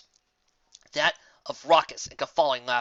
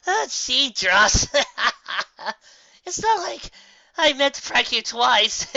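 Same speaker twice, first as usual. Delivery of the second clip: much higher words choked through laughs; can barely talk for laughing; wheezing laughter; 12 semitones higher, 6 dB louder, slower than usual. Acted. Dash laughs at her muddy victim, wheezing through laughter. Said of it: "choke out a reply through her fits of laughter".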